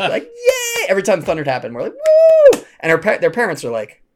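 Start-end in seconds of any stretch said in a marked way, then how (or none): 0.2-0.9 very high, louder yay; maniacal cheering. Retold or imitated. Counselor and two girls at thunder, maniacal cheer. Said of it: "cheering, like, maniacal". 1.9-2.6 loud high whoop; drawn-out, hollering "woo"; maniacal cheering. Retold or imitated. Counselor and girls as thunder hits, wild whoop. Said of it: "cheering, like, maniacal".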